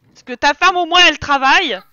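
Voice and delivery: loud, pointed dig; loud mock scolding. Real, not acted. Loudly scolding the lazy husband sim. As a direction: jab at him for not working.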